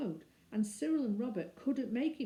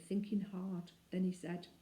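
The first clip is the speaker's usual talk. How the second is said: ordinary delivery, nothing marked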